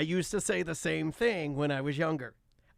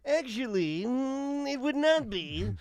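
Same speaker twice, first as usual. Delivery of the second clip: slow, higher, know-it-all drawl; smug correction; 5 semitones higher, much slower than usual. Pretend. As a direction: savor the correction, pausing smugly. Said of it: "and you get to go".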